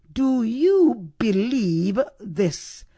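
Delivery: very slow, "believe" stretched out; each word set apart, "believe" held long; stress on BELIEVE. Shown as a demonstration. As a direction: spell it out slowly, weight on "believe". Issue of faith, stressed question.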